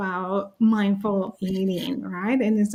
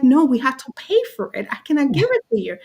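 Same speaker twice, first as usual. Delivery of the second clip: hurried explaining; 5 semitones higher, much faster than usual. Retold or imitated. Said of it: "And I was like".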